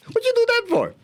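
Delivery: just got a glass of water in the face; shocked indignation. Retold or imitated. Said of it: "She goes".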